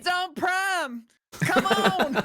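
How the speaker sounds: high, slow, laughing Dale Jr. voice; Dale Jr. impression. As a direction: do a hammed-up Dale Jr. whine. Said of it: "stupidly good Dale impression".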